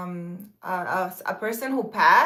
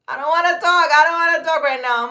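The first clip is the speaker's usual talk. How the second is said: loud, higher, firm no to the spirits; firm refusal; 6 semitones higher, 7 dB louder than usual. Retold or imitated. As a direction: push them away with a raised voice.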